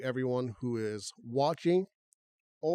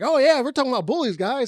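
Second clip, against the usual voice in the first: hyped-up host, much higher, much louder and fast; overexcited; 13 semitones higher, 14 dB louder than usual. Pretend. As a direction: gush like an over-the-top hype host. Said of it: "'cause I didn't wanna sound, like, so excited".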